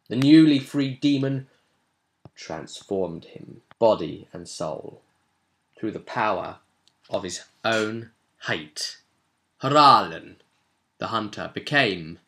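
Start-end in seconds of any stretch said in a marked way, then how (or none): none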